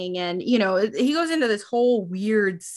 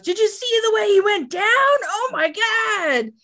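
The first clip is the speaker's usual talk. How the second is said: high-pitched, stretched-out glee at the end; acted excited exclamation; 11 semitones higher, 3 dB louder than usual. [name] marvelling at how Chris went down, excited exclamation. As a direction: exclaim it high, drawing out the last words.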